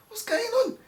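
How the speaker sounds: high-pitched, querying quote; retold question in a high voice; puzzled query. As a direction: voice your younger self asking, pitched well up. Acts out questioning the editor.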